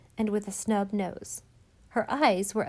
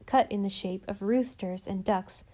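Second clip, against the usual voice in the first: plain reading voice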